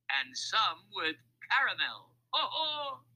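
high, hearty acted guffaw; laughing roll call. A narrator's reading. Mac continues listing candy, laughing roll call. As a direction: savour it, then burst into ho-hos. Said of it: "Mac continued his roll call".

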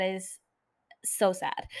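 soft unvoiced whisper. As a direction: whisper it like a sad secret. Sad aside about missing out.

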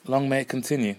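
usual speaking voice

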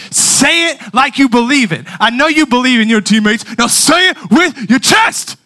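high, loud, building exhortation; fired-up coach. Retold or imitated. Coach slapping player's shoulder pad, fired-up yell. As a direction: drive it into the player, slowing at the end. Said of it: "No, he said".

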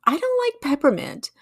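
mock whiny complaint. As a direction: whine it like a picky child. Imagined picky complaint.

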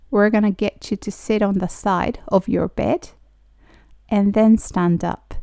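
slow, careful teacher pronunciation; slow model reading. Shown as a demonstration. Teacher reading slowly for students to repeat. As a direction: spell each word out slowly for learners. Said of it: "私と一緒に発音してもらったら".

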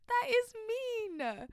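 very high and very slow, dragged out; drawn-out disapproval. Real, not acted. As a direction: stretch every word in mock outrage. Judging a cruel confession.

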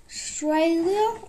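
a drawn-out Australian accent on Australia. Shown as a demonstration. Kid showing his Australian accent on Australia. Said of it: "say it in, like, an Australian accent".

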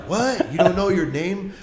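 retelling the squatter's stunned reply; incredulous what. Retold or imitated. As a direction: act baffled that he forgot his name. Retold squatter reacting in disbelief.

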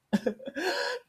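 high-pitched cackling laugh; shrill prankster cackle. Retold or imitated. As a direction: cackle at your own prank.

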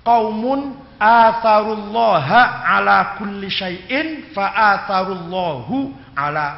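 slow, emphatic Arabic quotation. Real, not acted. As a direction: recite the Arabic deliberately, word by word.

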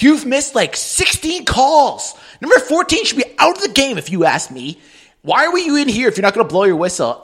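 high, nagging mock rebuke; imitated yelling. Boss berates the young ref, high-pitched mock yelling. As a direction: mimic someone scolding a young ref. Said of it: "one person always used to yell at me".

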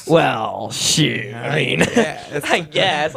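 retold aw-shucks modesty with a little laugh; bashful, flattered. Man retelling his reply to mom's boss, mock bashful. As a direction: play it coy, giving in to flattery. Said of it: "I was blushing a little bit".

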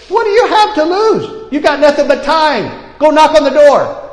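lightly voiced spirit, high and urging; inner voice of the spirit. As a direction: call out high and bold like a voice from within. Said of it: "he heard in his spirit".

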